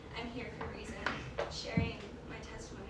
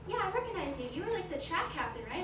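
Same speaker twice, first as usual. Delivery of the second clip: higher, somewhat louder quoted voice; lightly excited recognition; 6 semitones higher, 4 dB louder than usual. Retold or imitated. Girl recognizing the track captain, mildly excited. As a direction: voice a friend's pleased recognition.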